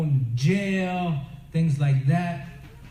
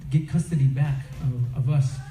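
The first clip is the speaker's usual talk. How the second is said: ordinary talk, nothing marked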